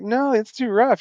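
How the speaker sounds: high, worried objection; alarmed protest. Retold or imitated. Telling daughter the dog game is too rough, high and alarmed. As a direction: pitch up in alarm.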